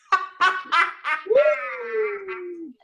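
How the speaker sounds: long high shrieking laughter; helpless high-pitched cackle. Real, not acted. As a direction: burst out in a wailing, high laugh. Bursts out laughing at PayPal excuse.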